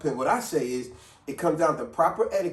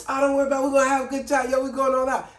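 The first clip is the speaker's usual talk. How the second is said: mock carefree boast, pitched up and louder; voiced friend; 9 semitones higher, 4 dB louder, faster than usual. Imitating a friend who says they've got the bill, up and loud. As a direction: play the big spender promising fun.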